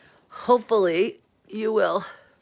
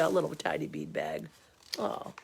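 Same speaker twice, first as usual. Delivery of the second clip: 1 soft coo; 6 semitones lower, 9 dB quieter than usual. Real, not acted. Cooing over cat picture.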